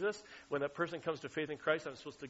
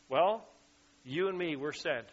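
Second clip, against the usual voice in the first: ordinary delivery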